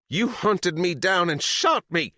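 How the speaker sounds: slow, high, loud accusing scream. Acted. Sadie screams at Chop about being hunted, slow and loud. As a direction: spit out every word at the man who shot her. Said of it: "Sadie screamed".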